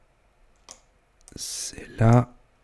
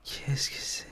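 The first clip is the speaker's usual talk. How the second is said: whispering; 14 dB quieter than usual. Acted. Frightened whisper alone at home.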